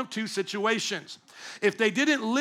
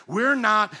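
ordinary delivery

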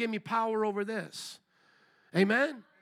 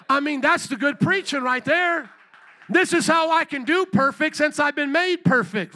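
loud, higher, driving preacher cadence; rally the congregation, full force; preaching; 4 semitones higher, 4 dB louder than usual. Acted. Pastor urging his church to live it out, fiery preaching.

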